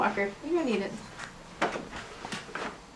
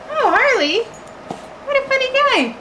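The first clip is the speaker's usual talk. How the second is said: excited dog talk; 8 semitones higher, 5 dB louder than usual. Real, not acted. Cooing at the dog.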